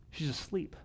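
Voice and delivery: airy laughing half-whisper; tossed off with a breathy laugh; light laughing aside. Retold or imitated. Jesus voiced at mourning house.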